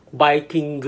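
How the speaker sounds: "Viking" said loudly as "baikingu"; Japanese pronunciation. Shown as a demonstration. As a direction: demonstrate "Viking" with b for v and final gu. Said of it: "Viking or Vikingu".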